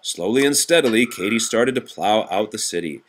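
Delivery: usual speaking voice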